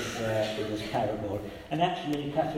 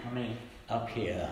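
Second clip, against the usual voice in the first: usual speaking voice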